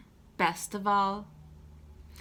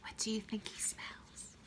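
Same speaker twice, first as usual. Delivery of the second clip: whispered aside; 14 dB quieter than usual. Real, not acted. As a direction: lean in and whisper the question. Aside question to child listener.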